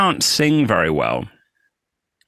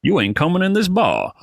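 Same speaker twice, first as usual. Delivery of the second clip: put-on Arkansas doorman accent laying down the law; Little Rock doorman; 6 semitones higher than usual. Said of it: "And he was just like".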